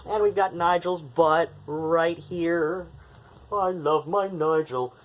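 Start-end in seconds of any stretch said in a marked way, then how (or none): 3.5-4.9 doting pet voice. Real, not acted. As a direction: gush over Nigel. Doting on cat Nigel.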